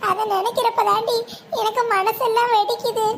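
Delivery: usual speaking voice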